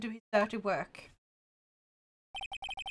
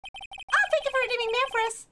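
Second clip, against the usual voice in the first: cutesy high voice; 11 semitones higher than usual. Acted. Voicing a game character's line.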